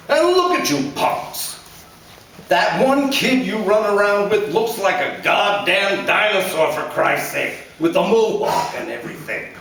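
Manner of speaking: a blustering father scolding his son; angry father. A narrator's reading. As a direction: lecture him like a bitter dad. Father mocks son's friends.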